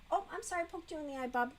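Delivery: high, soft apology to a pet; small, soothing pet-talk voice; tender apology. Real, not acted. Apologizing to the pet just poked.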